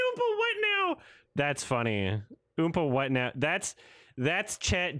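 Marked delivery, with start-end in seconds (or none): 0.1-1.0 very high-pitched incredulous squeal; squeaky mock astonishment; mock disbelief. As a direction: parrot the line in hammed-up disbelief. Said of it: "He said".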